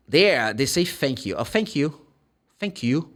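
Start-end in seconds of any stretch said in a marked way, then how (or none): 0.8-1.4 "thank you" as "fank you"; Cockney TH. Shown as a demonstration. Teacher showing how Cockney speakers talk, f for th in "thank you". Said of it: "They speak like this". 1.4-3.1 "thank you" said as "fank you"; Cockney TH. Shown as a demonstration. Teacher imitating Cockney speakers, th as f in "thank you". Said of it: "They speak like this".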